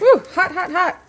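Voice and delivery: sudden high yelp, hot hot hot. Real, not acted. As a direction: yelp as if burnt fingers. Touching hot steak from pan.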